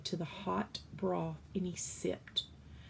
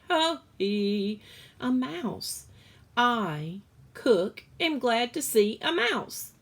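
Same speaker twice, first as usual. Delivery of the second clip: jolly laugh; 6 semitones higher, 8 dB louder, slower than usual. Acted. Cook laughing.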